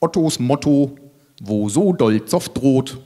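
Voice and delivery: slow, droning monotone; monotone defiance. Acted. Otto stands up to the ranting monologue, monotone. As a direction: drone it out stubbornly on one note. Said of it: "Trotzt Otto monoton".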